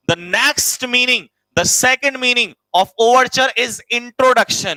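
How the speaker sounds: very slow, word by word for note-taking; slow dictation. Shown as a demonstration. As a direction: dictate slowly so students can write. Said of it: "दूसरा meaning होता है बेटा". (धीमा श्रुतलेख)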